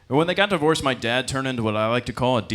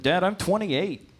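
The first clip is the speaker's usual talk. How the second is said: slow exasperated reply; 7 semitones higher than usual. Retold or imitated. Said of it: "It's like".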